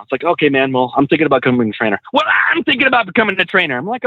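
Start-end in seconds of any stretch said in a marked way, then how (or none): 2.1-3.8 high-pitched mock imitation; mimicked eager guy. Repeats the quote, mimicking him.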